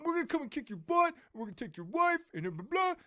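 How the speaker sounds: very high, loud cartoonish threats; mock movie henchman. Goon threatening the action hero, high mocking shout. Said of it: "some guy's just like".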